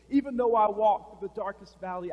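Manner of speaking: loud ringing proclamation of faith; bold psalm. Retold or imitated. David answering the threat with Psalm 23, loud and ringing. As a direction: declare it over the crowd. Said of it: "maybe he's gonna respond like this".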